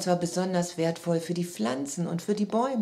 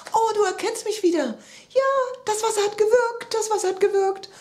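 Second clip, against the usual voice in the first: very high, loud jubilant squeal; overjoyed; 13 semitones higher, 6 dB louder than usual. A narrator's reading. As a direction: cheer with giddy relief as the little character. Child overjoyed at grandpa's recovery. (jubelnd vor Freude)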